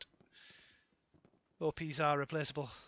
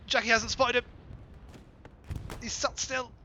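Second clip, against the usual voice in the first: excited commentary; 7 semitones higher than usual. Real, not acted. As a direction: pitch it up as the joke lands. Caster gets excited over a sitting target.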